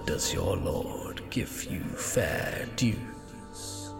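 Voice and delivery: slow, unvoiced sinister whisper; ghost voice. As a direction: hiss it low from beyond the veil. GM voices tempting entity.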